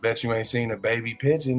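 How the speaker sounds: ordinary delivery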